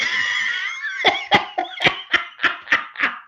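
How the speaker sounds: a real, high, shrieking burst of laughter; howling laugh. Real, not acted. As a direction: howl with laughter, out of control. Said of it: "I'm howling laughing".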